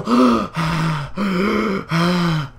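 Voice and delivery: long, heavy mock panting; exaggerated breathless gasping. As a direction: gasp theatrically as if blown away. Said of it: "Smash's play is just making me".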